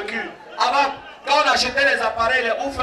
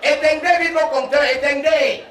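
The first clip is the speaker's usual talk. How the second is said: neighbour's warning shout; 4 semitones higher than usual. Retold or imitated. Electrician on the pole shouting to neighbours to cut power. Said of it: "J'entends seulement".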